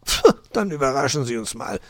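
sulky, mildly hissed retort; hissing. A narrator's reading. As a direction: puff out scorn and give way. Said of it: "zischte er".

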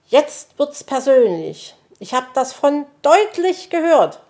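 high, loud indignant outburst; angry shouting. A narrator's reading. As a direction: take offence and shout it. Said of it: "schrie Zwitschi wütend".

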